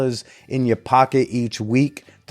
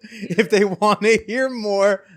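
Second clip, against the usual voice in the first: high, slow and laughing through it; laughing slow question; 9 semitones higher, slower than usual. Real, not acted. Host laughing as he asks where to find her. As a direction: drag the question out while cracking up.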